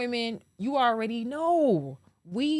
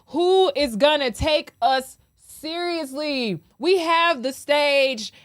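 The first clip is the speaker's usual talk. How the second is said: slow, loud rhetorical demand, each word hammered; slow emphatic outrage; 5 semitones higher, 5 dB louder, slower than usual. Real, not acted. Asking the ladies who will take them seriously, slow and loud. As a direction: plead it louder, slowly, like a sermon.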